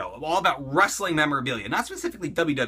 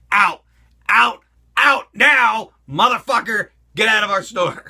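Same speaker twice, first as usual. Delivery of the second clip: imitating store workers yelling, louder and slow; mock staff yelling; 4 semitones higher, 7 dB louder than usual. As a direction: drag out each out, hammed-up bouncer shouting. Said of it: "They're like".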